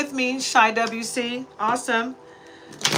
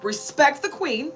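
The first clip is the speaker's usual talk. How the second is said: loud, higher call for attention, a playful queenly command; mock announcement; 7 semitones higher, 10 dB louder than usual. Telling her followers how she started her day, a loud commanding call. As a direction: raise the voice and summon the room to attention. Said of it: "I was like".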